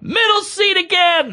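high, stretched-out mock wail; exclaimed punchline. Comic ending the airport story, high drawn-out mock despair. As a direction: cry it out slowly as a callback.

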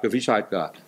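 ordinary talk

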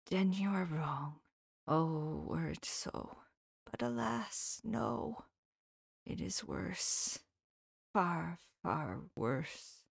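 hushed solemn whisper, far far slow; solemnly. Acted. Professor gravely whispering to his friend that it is worse. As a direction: let the dread sink in quietly. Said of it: "said solemnly".

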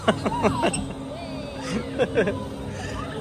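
a real, high, breathless giggle as she slides; breathy giggle. Real, not acted. Sliding off her seat on the ride, laughing helplessly.

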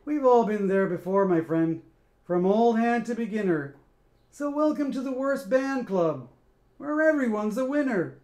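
mocking. Scat mocks the band, loud sneering welcome. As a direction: sneer at them with fake welcome. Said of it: "a mocking bow".

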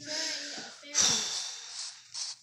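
long, high sighing exhale; drawn-out role-play sigh; exasperated sigh. Pretend. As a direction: huff out a pretend fed-up sigh. Wants to be left alone.

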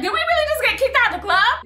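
high, rising, stunned question; can't believe they got thrown out; stunned disbelief. Retold or imitated.

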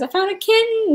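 put-on high squeaky kid voice; squeaky friend voice. Retelling a viewer's comment, high squeaky excited voice. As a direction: squeal it like an excited little girl. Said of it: "She goes".

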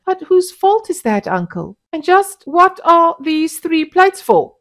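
high, loud irritated demand; annoyance. A narrator's reading. Husband annoyed at his wife over three plates, high and loud. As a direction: snap at her over the plates. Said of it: "said Mustapa with annoyance".

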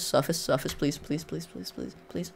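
very soft, fading repeated begging; hushed frantic muttering; desperate pleading. Real, not acted. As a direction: plead over and over, fading to almost nothing. Pleading to reach surface before drowning.

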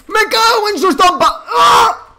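screaming curse, very high and loud; furious scream. Real, not acted. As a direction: explode in rage at the screen. Killed, screaming.

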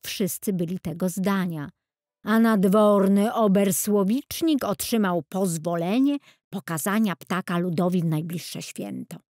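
usual speaking voice

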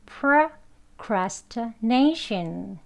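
procrastination very slowly, pruh-cras-tuh-NAY-shun; schwa, weak vowels. Shown as a demonstration. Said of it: "슈아 발음으로 약한 발음 여기 신경 쓰면서". (procrastination을 슈아 발음으로 끊어서 천천히)

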